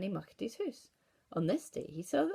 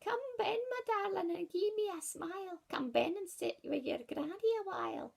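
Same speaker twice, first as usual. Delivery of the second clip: high coaxing granny voice; wolf calling the girl inside, high granny voice; 11 semitones higher than usual. Acted. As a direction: lure her in with a falsely sweet old voice. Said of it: "when the wolf said".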